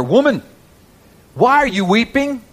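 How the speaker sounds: high, ringing questions; urgent appeal. Acted. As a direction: call out to her from the pulpit. Acts out Jesus questioning Mary at tomb.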